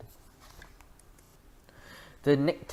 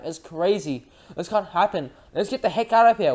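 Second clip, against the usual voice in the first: higher, louder panic; panicked shouting; 6 semitones higher, 5 dB louder than usual. A narrator's reading. Frightened diners fleeing the mice, shouting. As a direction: yell in fright.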